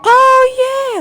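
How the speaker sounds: long, very high-pitched whoop; excited cheer. Real, not acted. Player nearly arriving in Fontaine, high excited cheer. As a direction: squeal it out, stretched and thrilled.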